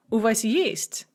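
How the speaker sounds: ordinary delivery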